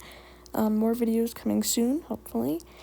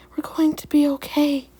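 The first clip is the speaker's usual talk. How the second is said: louder, higher, uplifted resolve; hopeful; 4 semitones higher, 7 dB louder than usual. A narrator's reading. Leaf Paw thinking as she races along, hopeful. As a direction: declare it with rising hope.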